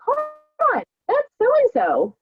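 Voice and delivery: high, slow, startled recognition; surprised double take. Retold or imitated. Spotting a former coach sponsored on Facebook, startled. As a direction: stretch it out in disbelief.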